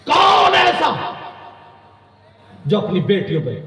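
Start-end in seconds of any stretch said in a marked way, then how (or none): 0.1-1.2 long, high shouted question; slow full-force preaching call; preacher's shout. Real, not acted. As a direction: stretch the words into a soaring cry. Preacher calls out to crowd.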